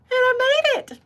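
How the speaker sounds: high, sing-song celebration of a win; triumphant cheer. Real, not acted. To her viewers after her first live, a high triumphant cheer. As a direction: crow it out, proud and thrilled.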